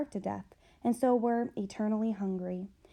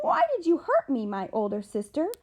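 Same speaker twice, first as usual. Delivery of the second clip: much higher and louder, then dropping; shrill accusation; 5 semitones higher, 7 dB louder than usual. Acted. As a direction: cry out at her sister in hurt anger. Younger sister accuses angrily.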